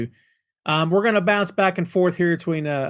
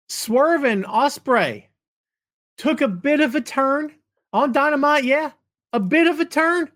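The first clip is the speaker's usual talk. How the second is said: ordinary delivery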